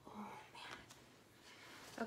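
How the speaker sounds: whispered, awed oh man; breathless whisper. Real, not acted. Whispering, overwhelmed by the gifts.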